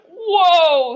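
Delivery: loud startled whoa; sputtering. A narrator's reading. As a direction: blurt it out through a scarf. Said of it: "sputtered Grandpa Dan".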